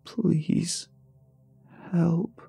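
put-on high, strained old man's plea; strained and gravelly. A narrator's reading. Old caller begging the hotline worker for help, strained and gravelly. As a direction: force words through damaged vocal cords. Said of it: "The words were strained and gravelly".

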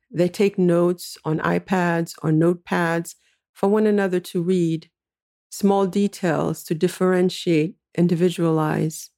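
ordinary delivery

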